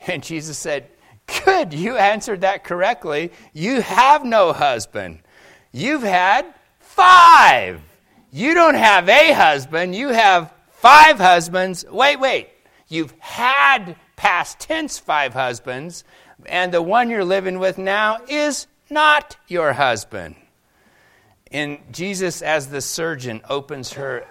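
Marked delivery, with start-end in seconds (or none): 1.4-11.8 louder, higher, slower words; emphatic exclamation. Retold or imitated. Jesus confronting the woman. 11.9-20.4 high, slowed correction stressing "had"; emphatic correction. Acted. Preacher stresses past tense.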